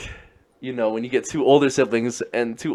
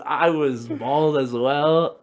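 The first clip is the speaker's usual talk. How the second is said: normal talking voice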